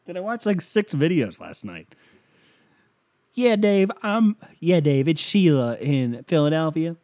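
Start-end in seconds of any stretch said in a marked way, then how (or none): 3.3-7.0 high, loud southern woman caller; drawn-out mock southern greeting; southern accent. Sheila phoning the money show, mock southern accent. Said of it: "she has a southern accent".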